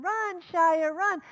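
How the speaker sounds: loud, high shout; shouting a runner home; crowd shouting. Retold or imitated. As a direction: yell him round the bases. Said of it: "everyone's screaming".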